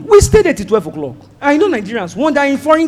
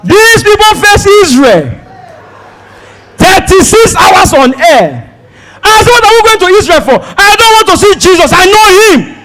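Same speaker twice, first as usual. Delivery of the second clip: exasperated rant; 9 semitones higher, 4 dB louder than usual. Retold or imitated. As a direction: vent loudly at the endless stops. Exasperated ranting about detours.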